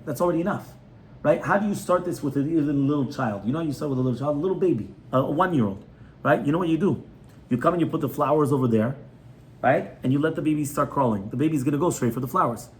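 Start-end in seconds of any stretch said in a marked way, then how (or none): none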